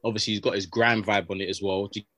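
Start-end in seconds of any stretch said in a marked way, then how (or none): none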